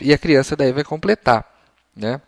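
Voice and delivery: ordinary talk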